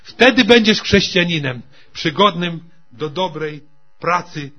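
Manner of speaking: loud, rising sermon climax; preaching at full force. Real, not acted. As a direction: drive it home to the congregation.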